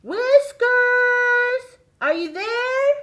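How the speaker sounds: high, loud sing-song call. Acted. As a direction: call out to someone in the next room. Calling the puppet.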